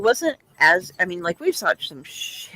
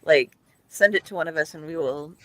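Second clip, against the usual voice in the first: normal talking voice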